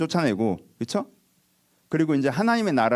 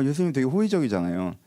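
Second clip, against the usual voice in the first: ordinary delivery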